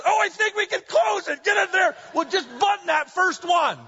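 hammed-up eager son pushing; imitating son. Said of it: "He goes".

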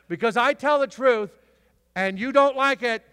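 very loud, high shouted sermon climax; preaching at full force. Acted. Preacher thundering about the truth to the church. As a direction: drive it home to the congregation.

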